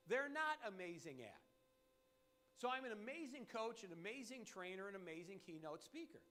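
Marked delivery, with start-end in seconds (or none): none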